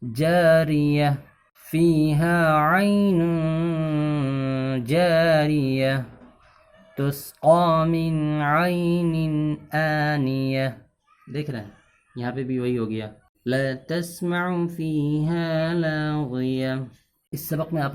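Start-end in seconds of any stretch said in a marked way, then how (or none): 0.0-1.1 recited pause form. Acted. Teacher recites the pause form for students. 1.6-10.7 very slow, melodic tajweed chant; Quranic recitation. Acted. Teacher recites the lesson verses, slowly chanted. 13.4-16.7 very slow, melodic tajweed chant; Quranic recitation. Acted. Teacher recites the lesson verses, slowly chanted.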